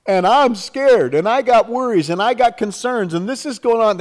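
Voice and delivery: high, anxious listing of worries; worried pleading. Acted. Believer unloading a list of worries to God, worried pleading.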